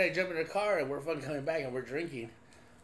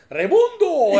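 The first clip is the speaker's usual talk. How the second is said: "Raimundo" with a long rolled R, high and loud; loud, high, laughing "Raimundo" with trilled R; 12 semitones higher, 14 dB louder, slower than usual. Retold or imitated. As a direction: roll the R in "Raimundo" big and proud. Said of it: "His, his R's got long".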